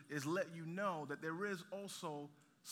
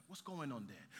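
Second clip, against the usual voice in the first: usual speaking voice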